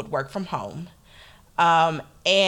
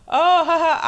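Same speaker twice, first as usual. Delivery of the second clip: awkward laugh; 12 semitones higher, 7 dB louder than usual. Retold or imitated. Coworker's awkward laugh retold.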